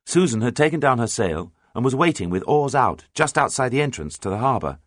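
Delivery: ordinary delivery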